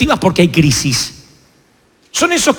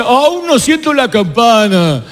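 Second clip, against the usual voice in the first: slow, dogged mock boxer voice; stubborn Rocky; 3 semitones higher, slower than usual. Rocky refusing to quit before the bell, slow and dogged. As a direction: grit it out, still standing. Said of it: "Y él decía".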